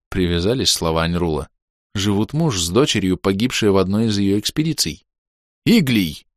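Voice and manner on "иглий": short sharp call of the name; mild mock bark at the ghost. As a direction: snap his name out. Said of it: "рявкнул я".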